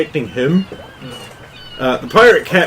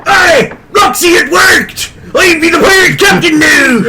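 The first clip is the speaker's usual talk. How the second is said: gleeful pirate captain; 7 dB louder than usual. Acted. Pirate captain turning to Roxy in triumph, laughing pirate shout. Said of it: "and says".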